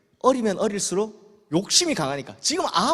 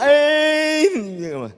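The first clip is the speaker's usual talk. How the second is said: put-on high, long-drawn childish whine; sulking five-year-old; 8 semitones higher, much slower than usual. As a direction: whine like a kid told no. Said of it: "다섯 살짜리 애기 같습니다".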